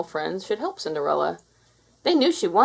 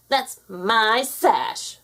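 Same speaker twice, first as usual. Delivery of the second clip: jealous stepsister; 4 semitones higher, 4 dB louder, much slower than usual. A narrator's reading.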